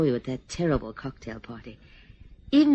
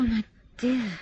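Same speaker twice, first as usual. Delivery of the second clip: normal talking voice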